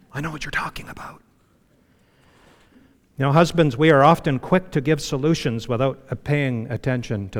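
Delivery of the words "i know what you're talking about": fast, breathy knowing whisper. Retold or imitated. Wives agreeing with the preacher, fast knowing whisper. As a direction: whisper it like a wife agreeing on the side. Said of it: "I see lots of wives going".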